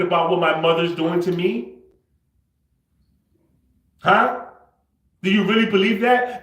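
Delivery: loud, high-pitched outburst, building; angry challenge. Real, not acted. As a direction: demand an answer in real frustration, voice climbing. Angry challenge, later admits frustration.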